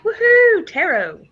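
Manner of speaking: a playful half-cheer; mock cheer. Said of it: "Now I'm just kinda like".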